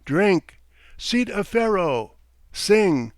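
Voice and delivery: high, loud, separate barked orders; shouted commands. A narrator's reading. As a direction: call them out over a rowdy party. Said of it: "Frolov shouted to them".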